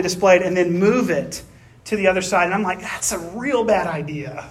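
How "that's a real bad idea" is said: retold doubt. Worker doubting the manager's display plan, mild retold misgiving. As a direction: mutter a retold doubt slowly.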